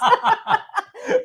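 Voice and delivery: high giggling laugh. Real, not acted. Guest laughing at the t-shirt joke, high giggle.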